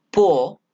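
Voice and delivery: "po" given full weight by itself; stressed syllable alone. Shown as a demonstration. Teacher isolates the important syllable, stressed. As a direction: lean firmly on "po". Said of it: "is given importance here".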